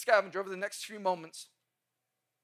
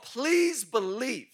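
slow emphasis; 3 semitones higher, 5 dB louder, much slower than usual. Real, not acted. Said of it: "slowed emphasis".

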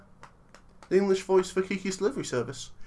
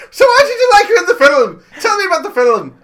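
much higher and louder fake Irish; over-the-top Irish accent; 13 semitones higher, 13 dB louder than usual. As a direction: ham up a terrible Irish accent. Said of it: "It's meant to be Irish".

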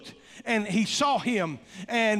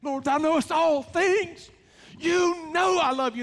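upset; 7 semitones higher than usual. Retold or imitated. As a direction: press it like a hurt friend. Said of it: "he really got upset and said".

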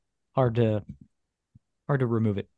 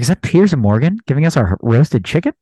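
loud mock-British accent; Piers Morgan in British accent; 9 dB louder than usual. Joking about a British Airways flight attendant, put-on British accent. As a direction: put on an incredulous English accent, loudly. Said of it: "I think Piers Morgan is now working".

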